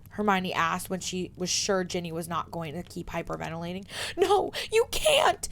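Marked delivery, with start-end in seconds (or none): 4.2-5.5 very high, loud, slow tearful plea; a sobbing girl stopping her friend fetching help; panicked cry. Acted. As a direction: cry out in alarm through tears. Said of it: "Ginny said immediately".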